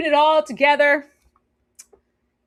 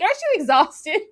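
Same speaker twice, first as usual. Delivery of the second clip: higher, rising mocking "Aren't you exhausted?"; mock question; 7 semitones higher than usual. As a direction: push "you" up into a teasing rise. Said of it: "It's like".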